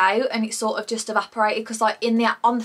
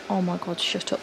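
normal talking voice

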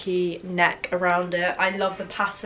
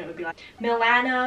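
nasal American Milano with flat a; American accent; 2 semitones higher than usual. Shown as a demonstration. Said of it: "that annoying nasally voice".

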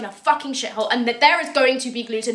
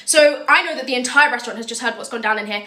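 ordinary delivery

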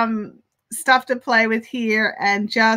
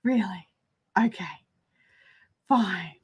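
Really? dropped low and softer, flat; resigned; 4 semitones lower, 7 dB quieter than usual. Retold or imitated. Reacting to mum, Really? low and deflated. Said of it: "It's just like".

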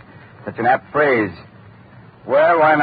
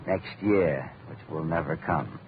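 low, much softer, sinking away; quiet and grim; 8 semitones lower, 9 dB quieter, slower than usual. Acted. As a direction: let it fall to a near hush. Dying man on his fate.

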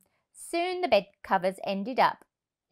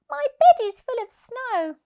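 much higher, small squirrel voice; a tiny cold squirrel pleading; 7 dB louder than usual. Acted. Squirrel complains.

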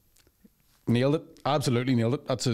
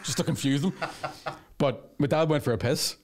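ordinary delivery

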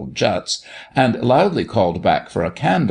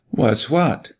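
mildly demanding, a little higher; imperative question; 4 semitones higher than usual. A narrator's reading. Doctor quizzing the girl, imperative. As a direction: put a question that expects an answer. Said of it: "manner that called imperatively".

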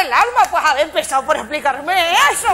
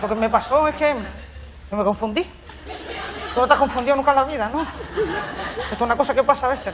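sulky, low voice; 8 semitones lower, 6 dB quieter than usual. Real, not acted. Sulky apology after mistake.

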